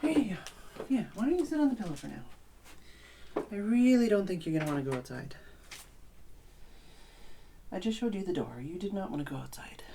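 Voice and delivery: high, gentle coaxing of a cat; pet talk. Acted. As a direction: sweet-talk the kitty softly.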